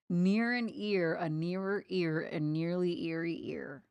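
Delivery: slow and careful; slow careful twister. Shown as a demonstration. Streamer carefully trying a tricky twister, slow. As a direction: spell out each word slowly. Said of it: "this one's messed up".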